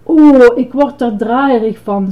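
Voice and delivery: loud dizzy "Oh", then easing off; sighing. A narrator's reading. Ice-cream man wobbling with the tower, sighing. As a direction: heave a weary complaint. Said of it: "zuchtte hij".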